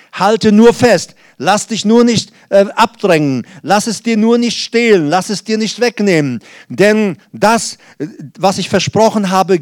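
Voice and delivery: rally the congregation with promise; preaching God's voice. Acted. (Prediger spricht Gottes Zusage gehoben und laut)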